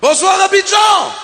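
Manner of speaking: shouted greeting. Acted. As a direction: belt out "Bonsoir Abidjan" to the crowd. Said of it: "Non, c'est pas bon".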